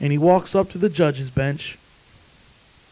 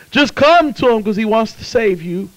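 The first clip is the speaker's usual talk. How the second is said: ordinary delivery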